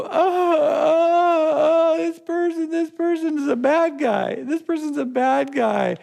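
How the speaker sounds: high, crying victim whine; whiny wailing victim. Acted. Playing the victim to the church, in a high wailing whine. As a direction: wail it like a self-pitying victim. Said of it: "I began to go".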